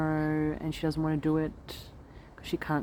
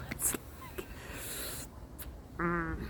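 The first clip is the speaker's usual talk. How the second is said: whispering; 10 dB quieter, slower than usual. Real, not acted. Unvoiced aside about parenting.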